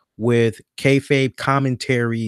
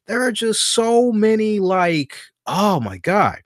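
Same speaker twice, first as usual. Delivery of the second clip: higher, rising exasperation over endless options; exasperated rant; 7 semitones higher than usual. Real, not acted. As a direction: list them off, increasingly fed up. Exasperated rant listing streaming services.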